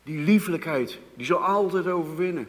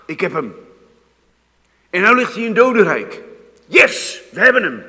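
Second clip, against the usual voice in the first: triumphant loud high gloat, much higher and louder; gloating power of death; 5 semitones higher, 13 dB louder than usual. Acted. The power of death gloating over Jesus in the grave, loud triumphant crowing. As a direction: crow over the captured victim like a victorious demon.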